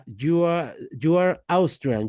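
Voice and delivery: ordinary talk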